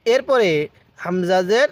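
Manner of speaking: ordinary talk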